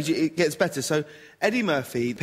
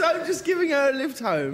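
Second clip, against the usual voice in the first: Eddie Murphy voice; 9 semitones higher than usual. Retold or imitated. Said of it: "So he says".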